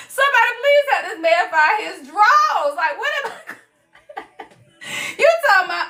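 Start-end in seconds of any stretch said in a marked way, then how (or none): none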